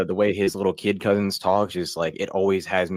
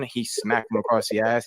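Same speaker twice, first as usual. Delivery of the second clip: ordinary talk, nothing marked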